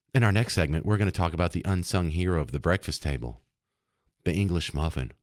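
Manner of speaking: low, hushed segment introduction; public radio parody. Retold or imitated. Radio host introducing a breakfast segment, hushed parody. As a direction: parody a sedate NPR host, voice dropped and soft. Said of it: "talk like the delicious dish skit".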